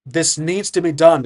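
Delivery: loud, firm council demand. Acted. Aggravated council addressing the two survivors, very loud. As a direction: raise your voice to fill the chamber. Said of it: "in a very loud vo-, voice".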